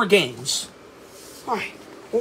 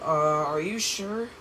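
ordinary talk

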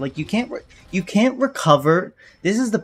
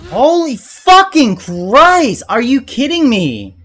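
raging gamer; 7 semitones higher, 4 dB louder than usual. Real, not acted. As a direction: drag out the rage. Another unfair death.

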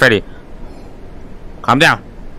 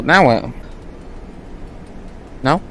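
ordinary talk, nothing marked

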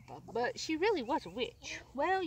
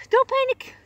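much higher, much louder; loud urgent don't panic; shouted reassurance; 13 semitones higher, 14 dB louder than usual. Acted. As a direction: shout to calm her down. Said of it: "she said".

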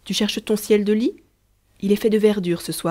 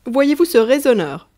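flustered, louder outburst; embarrassed; 5 semitones higher, 8 dB louder than usual. A narrator's reading. Father caught out by his son, flustered and louder. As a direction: bluster to cover embarrassment. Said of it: "dit Germain embarrassé".

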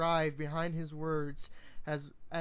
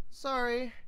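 yelled apology; 7 semitones higher than usual. Acted. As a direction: call back through the wall. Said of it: "Twilight yelled back".

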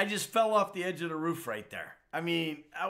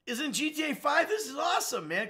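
higher, loud excited outburst; 11 semitones higher, 3 dB louder than usual. Retold or imitated. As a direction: recall the thrill of seeing him. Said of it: "I'm like".

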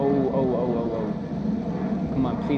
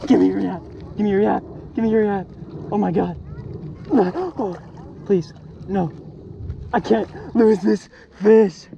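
high, loud, urgent pleading; loud freak-out; 7 semitones higher, 5 dB louder than usual. Real, not acted. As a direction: beg the fish loudly, scared to lose it. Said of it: "so much for not being loud".

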